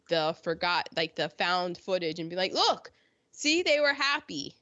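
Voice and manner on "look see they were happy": high-pitched mimicking of deniers; mock insistence. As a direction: point it out in mock triumph, higher. Mimicking deniers pointing at found footage.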